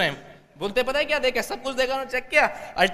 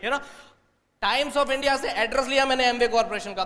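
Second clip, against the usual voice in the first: usual speaking voice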